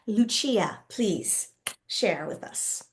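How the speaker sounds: usual speaking voice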